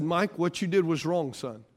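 soft, low father's apology; a quiet sincere sorry to his son. Retold or imitated. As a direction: apologise gently to your son. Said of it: "and I said".

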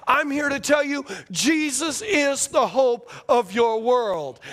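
rhythmic pulpit crescendo, landing deliberately; preaching. Real, not acted. Preaching climax to congregation.

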